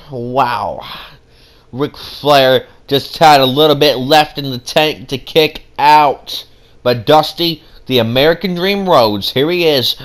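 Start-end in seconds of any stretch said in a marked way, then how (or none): none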